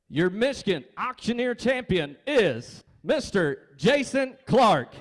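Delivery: big ceremonial announcer reveal, name drawn out; champion announcement. Real, not acted. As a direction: build to the winner's name and stretch it high.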